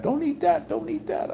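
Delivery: high-pitched mock scolding; mad scolding. Faye warning the speaker off eating dog, mad. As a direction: imitate a cross warning, voice shooting way up. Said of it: "That Faye was all mad".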